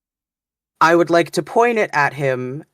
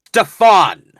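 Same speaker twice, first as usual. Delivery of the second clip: shouted name, loud and urgent; loud call; 7 semitones higher, 10 dB louder than usual. Acted. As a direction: call out his name loudly to wake him. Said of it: "deep and very loudly".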